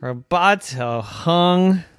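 usual speaking voice

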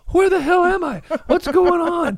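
high, loud, bewildered panic; confused panic. Retold or imitated. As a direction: cry out lost and scared in the dark. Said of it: "I was looking around going".

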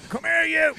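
very high, loud retold yell; mock confrontational shout. Mate leaves dropped phone to confront driver, mock yell. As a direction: holler it like someone starting a fight.

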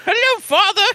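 very high little creature voice; tiny high-pitched talking blob; Flubber. Acted. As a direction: squeak it like a newly created goo creature. Voicing Flubber answering its creator.

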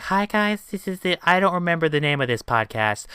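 high, small, hesitant voice; mock your timid beginner self; soft, lost podcast greeting; unsure newcomer. Mocking his unsure first-episode self.

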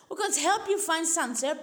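usual speaking voice

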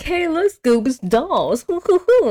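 high, goofy excitement; playful mock delight. Shaggy finding dolls to play with, goofy mock delight. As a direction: coo over it in a high silly voice.

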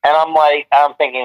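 ordinary talk, nothing marked

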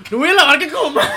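high, loud mock outrage; outraged refusal. Barista refuses manager's order, loud outraged protest. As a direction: protest at full voice, hammed-up.

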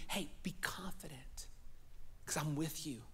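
softly whispered reassurance; tender whisper. Acted. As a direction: hush it like God speaking to the heart.

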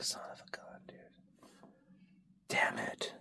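whispered mock curse. Moping over a bumpy ankle, whispered. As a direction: grumble it all in a whisper. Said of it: "You're like".

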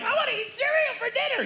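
put-on very high kid voice, earnest; a six-year-old asking for his dream; squeaky small-boy wish; little kid request. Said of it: "I'm like".

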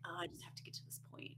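quick hushed self-grumble; frustrated muttering. Retold or imitated. Gamer stuck retrying a section, muttering in frustration. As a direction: mutter it very softly and fast. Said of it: "starts to get really frustrating".

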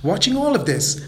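usual speaking voice

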